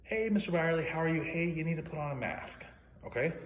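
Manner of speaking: high, bright imitation of a chirpy receptionist; mimicking receptionist. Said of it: "was like".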